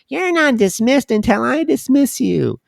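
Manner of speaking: high, mocking old lady voice; mock a strict old teacher. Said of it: "trying to do, uh, an old lady voice".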